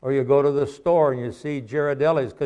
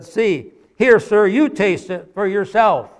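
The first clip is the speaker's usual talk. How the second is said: put-on higher, louder, fed-up little boy; exasperated boy; 6 semitones higher, 6 dB louder than usual. Preacher voicing the exasperated boy to the man. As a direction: hold out the bucket, out of patience. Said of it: "in exasperation".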